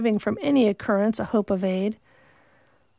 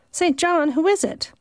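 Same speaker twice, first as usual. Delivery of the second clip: a higher, startled question; a startled sister asks, pitched up; surprised question; 7 semitones higher than usual. Acted. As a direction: ask in alarm who she is.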